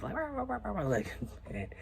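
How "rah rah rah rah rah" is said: high mock dog barking; playful high bark imitation; imitating a dog bark. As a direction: yap like an excited dog. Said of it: "barking and stuff".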